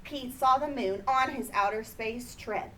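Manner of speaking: usual speaking voice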